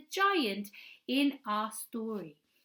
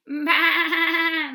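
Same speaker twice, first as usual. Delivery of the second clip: loud, high bleat; sheep bleat; 6 semitones higher, 11 dB louder than usual. Shown as a demonstration. Practising the bleating sound with the children, loud bleat. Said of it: "somebody bleating".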